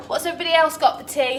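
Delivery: normal talking voice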